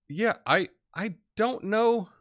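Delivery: a high, slow, drawn-out "I don't know"; doubtful. Real, not acted. Unsure reply to viewer comment.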